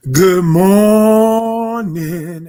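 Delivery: loud, slow, sung-out greeting; Sinclair voice. Retold or imitated. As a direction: stretch it long like a sitcom character. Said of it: "I'm doing the Sinclair this morning".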